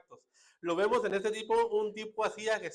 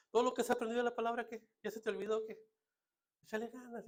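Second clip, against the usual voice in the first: high-pitched chiding well-wisher; mock encouragement; 6 semitones higher, faster than usual. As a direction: imitate someone glibly urging a struggler on. Acts out glib advice to sufferers.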